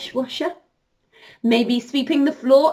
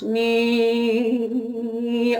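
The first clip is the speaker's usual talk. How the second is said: hoover noise; 2 semitones lower than usual. Pretend. Imitating the hoover.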